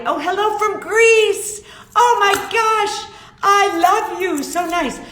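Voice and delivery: high, loud, slow gushing greeting. Real, not acted. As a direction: gush over a viewer who wrote in from Greece. Greets viewer from Greece gushingly.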